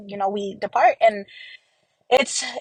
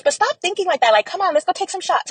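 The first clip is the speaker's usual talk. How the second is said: much higher, light coaxing; retold breezy brush-off; 10 semitones higher than usual. Sister in the dream brushing off the question, high coaxing. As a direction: pitch right up, wave the worry away.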